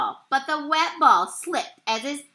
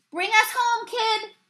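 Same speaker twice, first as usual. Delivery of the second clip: high, raised-voice call; teammate's shout; 10 semitones higher than usual. A narrator's reading. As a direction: call out to a batter from the bases. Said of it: "shouted Maloney".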